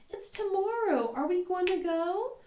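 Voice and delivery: put-on high, far-off little girl voice; eager girl. Jana piping up to mom, an eager high voice. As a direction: pipe up like a proud little girl. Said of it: "Jana pipes up with".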